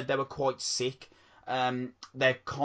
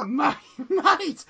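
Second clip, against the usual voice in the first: laughing; 4 dB louder than usual. Real, not acted. Laughing exclamation.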